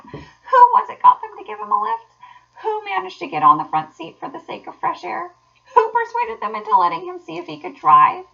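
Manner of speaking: high, loud triumphant boasting; gleeful, crowing self-praise; ecstatic self-admiration. A narrator's reading. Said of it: "he cried in ecstasies of self-admiration".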